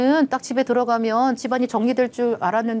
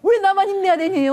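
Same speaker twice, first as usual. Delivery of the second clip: higher, louder whiny complaint; mock exasperated executive; 10 semitones higher, 4 dB louder than usual. Executive grumbling about always being told to cheer up, whiny. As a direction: whine like a worn-out boss. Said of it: "제일 싫은 노래가".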